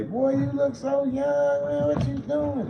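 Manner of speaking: high, slow marveling voice; imitating admirers. Retelling how everyone marveled at his look, high and slow. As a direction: draw it out like you can't believe it. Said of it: "Everybody yesterday".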